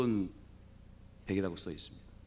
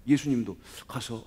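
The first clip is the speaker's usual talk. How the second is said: usual speaking voice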